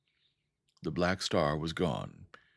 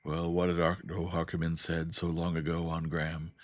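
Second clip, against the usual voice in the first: ordinary delivery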